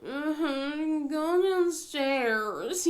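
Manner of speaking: half-asleep groan, words dragged way out; groggy dismissal. Retold or imitated. Tired mom sending her daughter away from bed, groggy. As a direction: imitate a sleepy parent, very slow and drawn-out. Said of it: "you know, like that".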